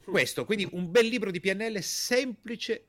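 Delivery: ordinary delivery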